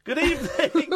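very high sing-out greeting; comic greeting. Pretend. Radio host greeting the next caller, comically high. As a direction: pitch it right up for a silly hello.

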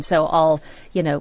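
ordinary delivery

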